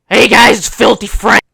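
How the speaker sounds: mock intro. Parodying youtubers, loud mock intro. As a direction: announce it like a hype host.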